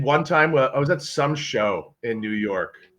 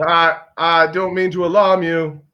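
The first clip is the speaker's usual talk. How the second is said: subway announcer. Retold or imitated. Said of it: "announcement on the subway".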